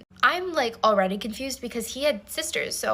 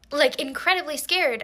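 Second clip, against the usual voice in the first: ordinary delivery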